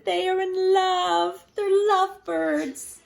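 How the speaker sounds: high, slow, squeaky-bright pig friend; Piggy voice. Acted. Reading to kids, Piggy tells Gerald, high and slow.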